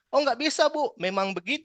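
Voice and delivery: mock irritated retort to a complaining customer; rude snapping reply. Shown as a demonstration. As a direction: bark it at the customer to show what not to do. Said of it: "jangan langsung marah".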